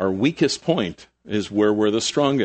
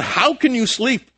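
much higher, slow disbelief; incredulous; 12 semitones higher than usual. Retold or imitated. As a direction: stretch it out, amazed he sleeps. Disciples wake sleeping Jesus.

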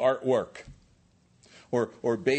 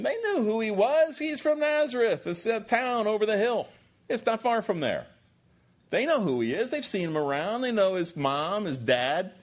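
very high, exaggerated know-it-all chatter; mock scoffing townsfolk; 10 semitones higher, 3 dB louder than usual. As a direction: play the doubters in a raised, high-pitched voice. Acting out townspeople's dismissive gossip.

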